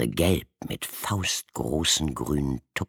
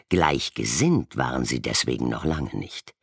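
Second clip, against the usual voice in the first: ordinary delivery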